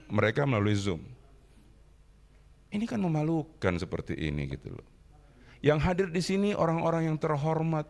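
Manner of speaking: ordinary delivery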